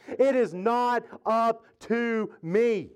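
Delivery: slow, word-by-word preaching; stretched, insistent declaration to the congregation; emphatic proclamation. Real, not acted. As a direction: hammer each word out slowly.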